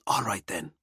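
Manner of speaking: voiceless whisper. A narrator's reading. Valkyrie whispering before stepping into the room. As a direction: whisper it, steeling herself.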